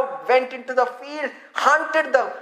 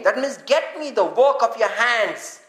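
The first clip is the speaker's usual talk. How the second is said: ordinary talk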